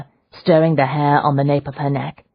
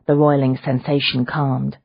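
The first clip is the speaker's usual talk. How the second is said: plain reading voice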